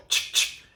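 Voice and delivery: whispered ch-ch stamping sound; stamp noise. Retold or imitated. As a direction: mimic an office stamper. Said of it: "where you'd go".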